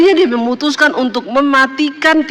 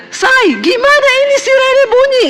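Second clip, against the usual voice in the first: panicked yell; 12 semitones higher than usual. Retold or imitated. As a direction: shout for your wife as the siren goes off. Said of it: "Suami saya teriak".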